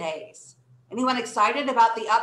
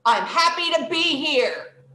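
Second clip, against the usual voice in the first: loud, higher, angry delivery; 5 semitones higher, 5 dB louder than usual. Shown as a demonstration. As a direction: sound angry despite the happy words. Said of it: "I sounded like I was angry".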